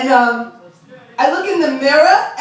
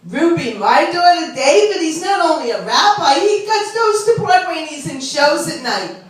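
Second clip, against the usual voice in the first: boastful Long Island mother; proud mother's parlor chatter; beauty parlor lady; 4 semitones higher than usual. Acted. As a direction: brag to a friend in a raised singsong. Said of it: "They both talk the same way".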